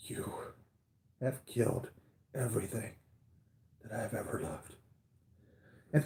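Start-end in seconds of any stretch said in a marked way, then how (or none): 0.1-4.7 a touch of a breaking voice, softer and fading; broken voice. Retold or imitated. Fan quoting Scarlet Witch to Thanos, mildly broken voice. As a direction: quote her grief, voice giving way. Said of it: "voice is just broken up".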